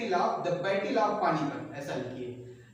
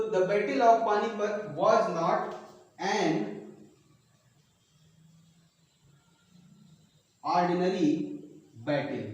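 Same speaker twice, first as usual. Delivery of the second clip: slow dictation; much slower than usual. Shown as a demonstration. Teacher dictating translation slowly.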